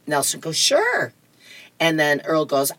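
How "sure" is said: put-on high-pitched kid's reply; agreeable grandson. Nelson answering grandpa in the backyard, high-pitched kid. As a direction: pipe up high like a little boy.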